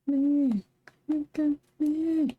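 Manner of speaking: high drawn-out plea for attention; attention-seeking call. Acted. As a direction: whine for someone to look. Demanding attention, high pitched.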